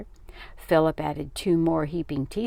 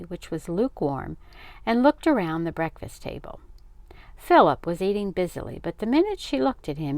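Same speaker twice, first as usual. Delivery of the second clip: usual speaking voice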